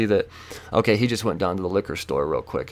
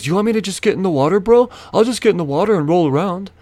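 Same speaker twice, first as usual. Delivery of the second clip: high, loud, mock-eager offer; imitating defusing offer; 10 semitones higher, 5 dB louder, faster than usual. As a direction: mimic him hurriedly offering to appease you. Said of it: "he diffuses it by going".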